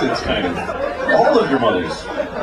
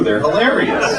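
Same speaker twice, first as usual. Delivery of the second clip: normal talking voice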